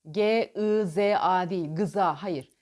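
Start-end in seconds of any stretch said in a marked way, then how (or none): 1.7-2.2 gıza with a clear ı between g and z; wrong vowel inserted. Shown as a demonstration. Coach demonstrating the wrong gza. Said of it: "gıza değil".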